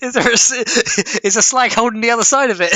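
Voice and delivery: high and loud through laughter, fast; squeezing out a question while cracking up; real laughing fit. Real, not acted. Laughing fit over chat message.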